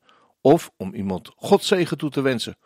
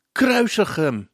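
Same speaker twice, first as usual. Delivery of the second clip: higher, restrained narrated call; mild crowd's cry; 7 semitones higher, slower than usual. A narrator's reading. Crowd calling for crucifixion in recited lyrics, mild cry. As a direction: voice the crowd's shout without really shouting. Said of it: "de menigte roept en schreeuwt".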